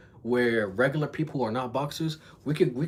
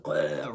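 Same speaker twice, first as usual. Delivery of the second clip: low retching "bleh"; mock puke noise, dropped in pitch; imitated vomiting; 9 semitones lower than usual. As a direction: mime throwing up with the voice. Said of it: "he was throwing up fucking blood".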